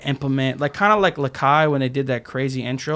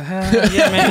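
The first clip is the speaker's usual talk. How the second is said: higher and louder, laughing through the cheer; 7 semitones higher, 6 dB louder, slower than usual. Retold or imitated. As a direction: cheer it out between laughs, hyped. Friend cheering while driving calmly.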